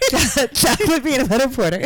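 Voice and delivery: laughing excited shout; game buzzer phrase. Pretend. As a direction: blurt it out mid-laugh. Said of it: "just scream out".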